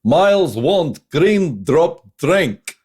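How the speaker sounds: slow, high-pitched mock Herkimer Homolka accent. Joking bar order for a green drop drink, Herkimer Homolka accent. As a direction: draw each word out in a hammed-up Homolka accent. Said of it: "a Herkimer Homolka accent".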